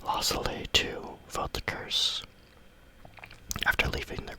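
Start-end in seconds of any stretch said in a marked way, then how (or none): none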